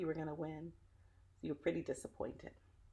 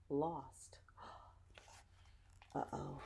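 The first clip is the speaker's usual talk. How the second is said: hushed, softer whispered letdown; dramatic whisper; 9 dB quieter, much slower than usual. Acted. Telling children the team lost, hushed whisper. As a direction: drop to a whisper for the sad news.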